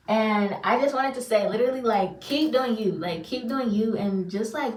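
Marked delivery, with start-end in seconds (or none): none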